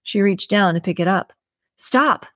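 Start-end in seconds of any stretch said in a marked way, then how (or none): 1.7-2.3 sharp, higher-pitched cry of warning; yelled stop. Acted. Susan stops Maggie touching the petal, sharp warning yell. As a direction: snap it out to halt her hand. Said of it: "Susan yelled out".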